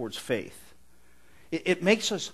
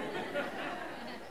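high laugh; 10 semitones higher, 10 dB quieter than usual. Real, not acted. Laughs at his own joke.

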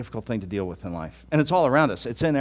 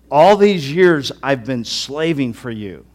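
slow, loud and bitter; an older son's loud, slow grievance; venomous complaint; 8 dB louder, slower than usual. Acted. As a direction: drag each word out with resentment. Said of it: "here comes out the venom".

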